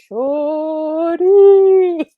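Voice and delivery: high, loud, drawn-out Southern catcall; horrible Southern accent. Retold or imitated. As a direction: holler "Shorty" in a bad Southern accent. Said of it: "I do a horrible Southern accent".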